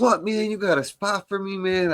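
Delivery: high mock buddy voice; quoting Mike. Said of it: "he goes".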